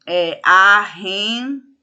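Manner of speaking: arrendar drawn out slowly, syllable by syllable; split into syllables. Shown as a demonstration. Teacher showing students how arrendar splits, slow syllables. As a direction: sound out arrendar slowly and clearly. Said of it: "Ou, por exemplo".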